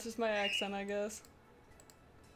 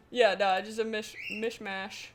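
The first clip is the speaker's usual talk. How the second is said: ordinary delivery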